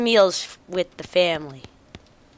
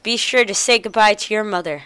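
normal talking voice

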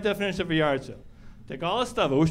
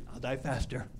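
weak dying whisper; 7 semitones lower, 9 dB quieter than usual. Retold or imitated. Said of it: "laying there dying".

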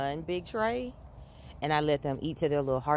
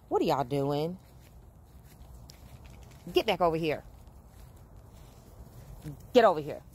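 stern mom calling the dogs back; mama voice. Real, not acted. Mom calls her puppies back in a firm mama voice. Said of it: "Gotta use that mama voice".

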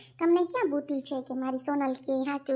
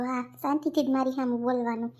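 ordinary talk, nothing marked

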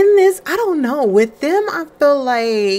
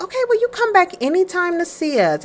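pitched-up friendly clerk, quick; chirpy higher shop clerk saying goodbye; high shopkeeper voice; 10 semitones higher, faster than usual. Acted. Shop clerk bidding the couple goodbye, high cheery voice.

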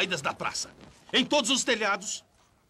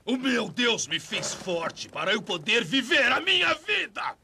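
defiant shout. Acted. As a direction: hurl it at the crowd. Villain defiant before the crowd.

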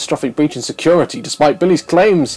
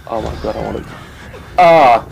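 ordinary delivery

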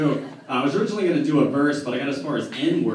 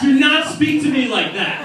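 indignant; 9 semitones higher, 6 dB louder than usual. Acted. Answering a practice heckle.

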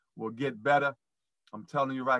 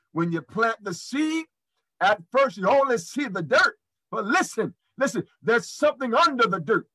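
rising, louder preacher cadence, peaking in a shout; preaching build-up; 8 semitones higher, 6 dB louder than usual. Acted. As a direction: preach it, climbing higher and louder up to the cry on "even". Host preaching about the seed.

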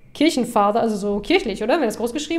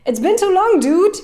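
excited greeting; 8 semitones higher, 5 dB louder than usual. Acted. Voicing the player meeting Craig.

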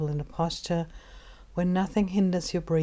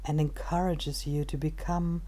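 calm, unhurried meditation guide with long pauses; measured body-scan narration, phrase by phrase; guided meditation; 7 dB quieter, slower than usual. Acted. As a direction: ease the listener into stillness, softer than everyday talk.

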